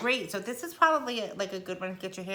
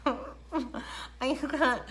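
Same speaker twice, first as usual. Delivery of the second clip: laughing; 7 semitones higher than usual. Real, not acted. Laughing at virtual learning struggle.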